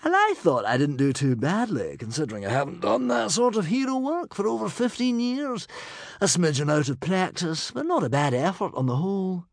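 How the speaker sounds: lightly boastful hero voice; breezy dashing hero. A narrator's reading. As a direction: toss it off like a modest show-off hero. Humongous the hero's voice.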